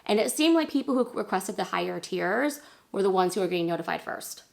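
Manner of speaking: normal talking voice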